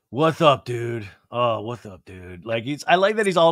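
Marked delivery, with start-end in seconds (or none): 0.1-2.4 low, deflated mopey dude; sad Sandoval impression. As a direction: sigh it out like a sad guy. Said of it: "like, sad".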